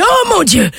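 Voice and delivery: ecstatic shouting. Retold or imitated. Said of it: "il se met à hurler".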